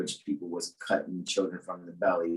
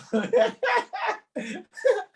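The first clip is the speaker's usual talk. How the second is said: high giggling laugh; amused burst of laughter. Real, not acted. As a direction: crack up at your own joke. Cracks up at own joke.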